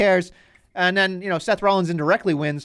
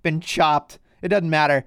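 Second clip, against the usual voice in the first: ordinary delivery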